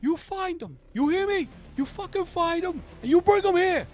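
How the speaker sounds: high, loud furious yelling at his men; furious boss shouting. Acted. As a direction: bellow orders like an enraged mob boss.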